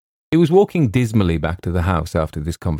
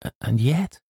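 higher, hesitant, rising tone; a little wistful; 6 semitones higher than usual. A narrator's reading. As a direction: trail upward with mild longing. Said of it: "a little wistfully".

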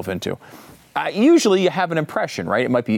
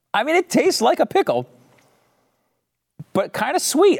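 excited; 12 semitones higher than usual. Real, not acted. Tasting a weird pickle lollipop.

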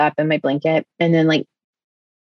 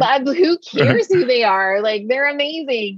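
high, elated relief; giddy relief; 7 semitones higher than usual. Retold or imitated. Rescued hostage hearing who saved her, high elated. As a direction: gush it out, high and thrilled. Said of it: "And I'm just like".

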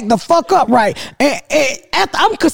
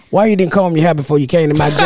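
low, quick mumbled-out complaint; imitating her son; 9 semitones lower, faster than usual. Mother mimics sick son complaining, low-voiced. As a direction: drop well down to mimic him.

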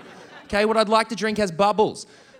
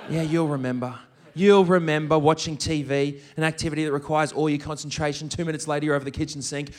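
mock the misplaced confidence; dry, low-pitched sarcasm; sarcastic mockery; 7 semitones lower than usual.

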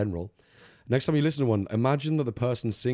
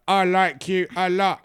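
slow, high, loud parody love poem; mock bad poet; 7 semitones higher, 6 dB louder, slower than usual. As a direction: ham it up as a clumsy amateur poet. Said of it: "expecting it to just be like".

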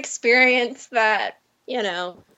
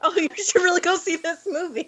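a hint of a quick, soft celebrity pitch; lightly voiced star urging you; mock Matt Damon plug; 4 semitones higher, 7 dB quieter, faster than usual. Matt Damon in the Moonlight trailer, quick mock plug. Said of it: "And he goes".

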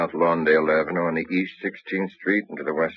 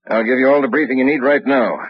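louder and higher, cutting the question off; sharp retort; 7 semitones higher, 7 dB louder than usual. Acted. Captain cutting off his detective, loud and firm. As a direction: bark it back with full authority.

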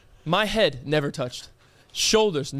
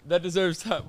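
ordinary delivery

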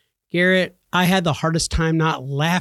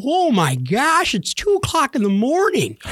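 high, drawn-out mock astonishment; retold shock; 8 semitones higher than usual. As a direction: gasp it out high, stretching the exclamation. Shocked at the clock while gaming.

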